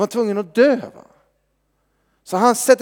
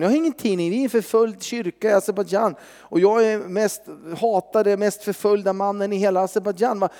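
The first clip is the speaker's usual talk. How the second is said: ordinary talk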